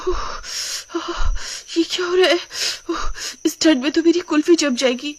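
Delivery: put-on high, shivering young wife; shivering with cold. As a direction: gasp and shiver through the words, pitched high. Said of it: "ठंड के मारे कांपने लगती है".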